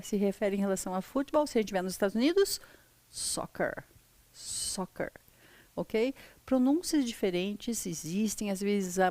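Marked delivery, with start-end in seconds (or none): 3.1-5.1 soccer whispered, no voice; whispered word. Shown as a demonstration. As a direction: breathe out soccer softly. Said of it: "Lembra da outra maneira que a gente fala". (a palavra soccer sussurrada)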